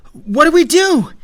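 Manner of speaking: high, loud panicked-question yell; high-pitched yell above the noise; yelling over noise. A narrator's reading. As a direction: shout it over the din. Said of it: "he yelled over the noise".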